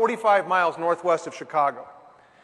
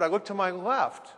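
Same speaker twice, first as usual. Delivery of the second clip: usual speaking voice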